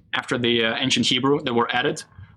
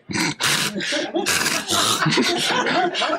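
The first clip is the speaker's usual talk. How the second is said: long high laugh; real laughter, much higher; laughing fit; 13 semitones higher than usual. Real, not acted. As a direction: break into helpless laughter. Laughs while writing Melech.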